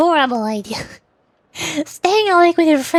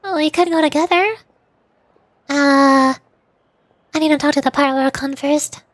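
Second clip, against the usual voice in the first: lively, higher-pitched character read; game character dialogue; 3 semitones higher than usual. Acted. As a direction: act out the breezy, confident adventurers.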